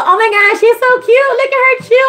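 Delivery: imitating the kids' high delighted squeal; gushing kids. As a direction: coo over grandma chewing. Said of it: "they go".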